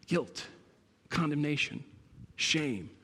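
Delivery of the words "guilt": ordinary talk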